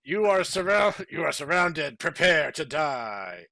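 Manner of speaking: loud, slowing menace; menacing dark man. Acted. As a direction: threaten them coldly to their end. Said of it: "the dark man said".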